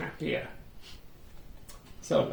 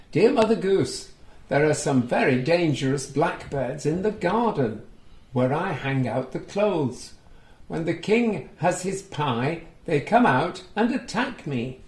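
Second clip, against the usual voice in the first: lively, sing-song storybook reading, a little higher and louder; reading to children; 3 semitones higher, 3 dB louder than usual. A narrator's reading. Reading a picture book aloud to young children, lively storytelling. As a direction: bring the story alive for little listeners. Reads the maid's letter.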